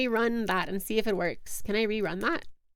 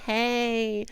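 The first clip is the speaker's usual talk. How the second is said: ordinary delivery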